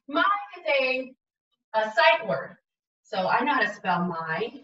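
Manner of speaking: ordinary talk, nothing marked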